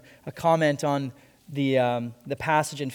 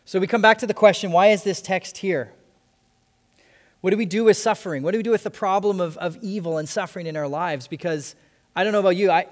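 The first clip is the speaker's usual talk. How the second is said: fervent preaching; 3 semitones higher, 4 dB louder than usual. Real, not acted. Rising preaching intensity.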